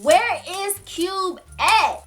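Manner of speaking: high, very slow, insistent mock demand; escalated demand. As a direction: stretch every word, imitating a caller losing patience. Demand repeated after evasive answer.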